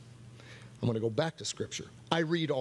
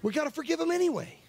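high, quick, urgent exhortation; impassioned insistence; 14 semitones higher, faster than usual. Real, not acted. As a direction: press it on them, pitch right up. Preacher presses a hard truth.

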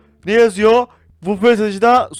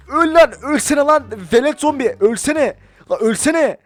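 frustrated yelling; 5 semitones higher, 3 dB louder than usual. Real, not acted. Fighting zombie in game.

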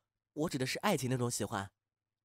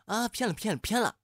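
panicked, rising cry of denial, higher and louder; flustered outburst; 9 semitones higher, 5 dB louder than usual. Acted. Alone at home, panicking over his feelings, flustered yelling. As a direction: shout it down in a fluster.

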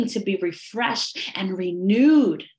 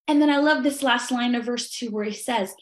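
ordinary delivery

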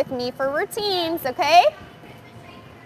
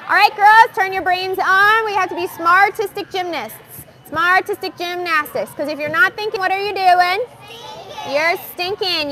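raised, higher coaching voice; rallying the class; 5 semitones higher, 3 dB louder than usual. Real, not acted. Coach rallies young gymnasts with their thinking motto, in a high, carrying voice. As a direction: call the group to attention.

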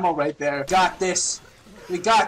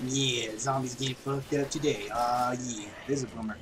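very low, soft drawn-out voice; low smug put-on voice; 9 semitones lower, 9 dB quieter than usual. Player comments on zombies dying, low put-on cool voice. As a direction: drop it deep and quiet.

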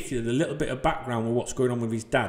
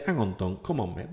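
imagined subscriber; 5 semitones lower, 6 dB quieter than usual. Retold or imitated. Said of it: "subscriber could say".

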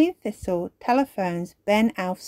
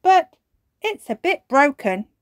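excited; 8 semitones higher, 6 dB louder than usual. Acted. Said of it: "cries Ben".

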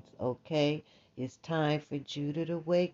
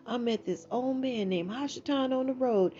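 raised, quick pleading son; Jack pleading; 8 semitones higher than usual. Retold or imitated. Jack explaining the beans to his mother, quick and raised. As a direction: talk fast to calm your mother down. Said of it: "Jack said".

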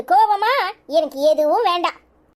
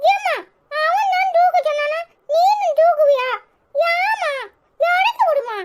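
put-on crying child; 10 semitones higher than usual. Child crying at mother carrying brother.

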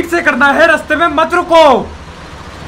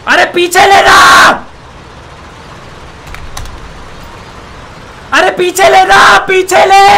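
yelling to reverse; 5 semitones higher, 3 dB louder, slower than usual. Real, not acted.

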